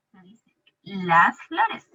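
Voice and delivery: "las flores" drawn out; teacher giving the answer; slow confirmation. Shown as a demonstration. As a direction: settle it slowly and clearly.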